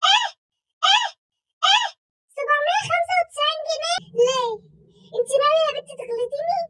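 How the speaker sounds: high, loud disbelief at the price; shocked outrage. Acted. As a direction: balk at the sum. Mom shocked at the amount. (صدمة عالية)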